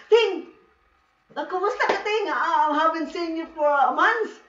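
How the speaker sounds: lightly voiced visiting friend calling out; friend's greeting. Voices friend greeting her papa.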